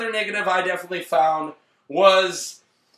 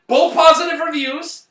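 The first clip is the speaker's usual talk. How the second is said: higher, louder hype shout; excited; 8 semitones higher, 5 dB louder than usual. Real, not acted. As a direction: announce it like a thrilled host. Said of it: "excited announcing".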